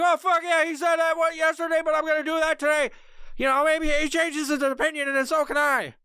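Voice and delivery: high mocking voice of an eager follower; dumb follower voice.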